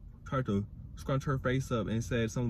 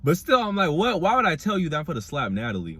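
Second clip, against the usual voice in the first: outraged loud; 4 semitones higher, 6 dB louder than usual. Real, not acted. Outraged reaction to the show.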